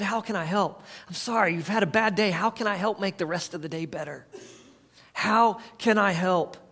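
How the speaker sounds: soft, kind offer of help; gentle and caring. Retold or imitated. Answering a snappy spouse with soft kindness. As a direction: soothe a hurting spouse.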